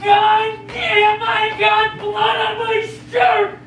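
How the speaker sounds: angry complaint. Acted. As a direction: curse and wail about it. Hurt and furious.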